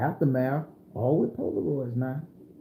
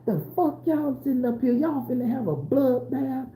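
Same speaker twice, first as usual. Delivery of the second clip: raised high-pitched furious grandmother voice; Grandma yelling at strangers; 9 semitones higher than usual. Retold or imitated. Grandma confronting the men who cornered her grandkids, in a high furious voice. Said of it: "Grandma was like".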